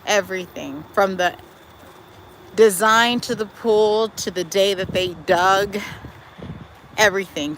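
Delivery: ordinary delivery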